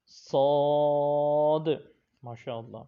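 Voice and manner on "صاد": صاد long, thick and loud with a whistle; heavy whistling saad. Shown as a demonstration. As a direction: stretch صاد full and heavy. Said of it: "میرے ساتھ مل کر پڑھیے". (صاد موٹا اور لمبا)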